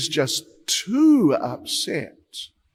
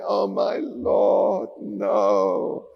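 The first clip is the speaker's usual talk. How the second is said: higher, desperate crying plea; 5 semitones higher, much slower than usual. Retold or imitated. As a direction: wail at the lord in despair. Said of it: "Guhaka is just crying and crying".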